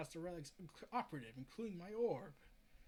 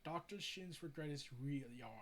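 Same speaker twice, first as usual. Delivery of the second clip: usual speaking voice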